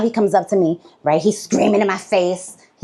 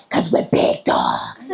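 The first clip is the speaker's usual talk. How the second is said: deep, slow cool boast; swaggering low boast; much slower than usual. Retold or imitated. Coach's punchline.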